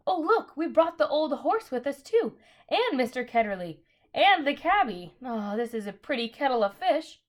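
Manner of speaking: high, loud excited exclamation; crying out. A narrator's reading. Polly noticing the horse came too, excited cry. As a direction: call out in surprise at what came along. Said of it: "Polly cried out".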